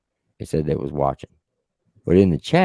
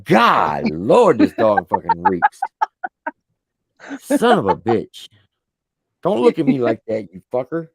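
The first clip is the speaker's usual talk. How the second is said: louder, exasperated, dragging out the disgust; exasperated scolding; 4 semitones higher, 5 dB louder than usual. Real, not acted. Owner scolding his smelly dog, loud and disgusted. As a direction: gripe at the stinking dog.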